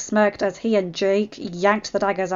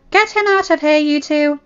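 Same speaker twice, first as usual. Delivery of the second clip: high, loud "Get him out of here"; urgent order; 8 semitones higher, 7 dB louder than usual. Acted. Claudine sending two helpers off with Strat, ordering. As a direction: order the pair away sharply. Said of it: "Claudine ordered".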